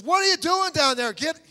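high, loud scolding yell; God's rebuke, hammed-up. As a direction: bellow it like an exasperated God. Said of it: "he may not have said it like that".

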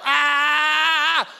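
loud high pained scream with tongue stuck. Retold or imitated. Preacher acting out his brother's tongue-stuck scream, loud and high. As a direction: scream like a kid with his tongue frozen to a can.

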